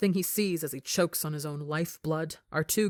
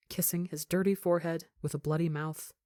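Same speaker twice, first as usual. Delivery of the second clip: usual speaking voice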